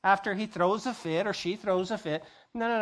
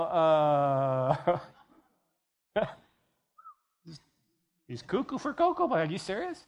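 skeptical friend. Acted. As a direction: scoff like the boss has lost it. Skeptical friend mocking pastor.

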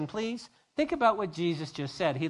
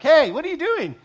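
louder, much higher hey what are you doing; mock yell; 9 semitones higher, 10 dB louder than usual. Owners calling out at the disciples taking donkeys, loud and high. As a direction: call out at the thieves.

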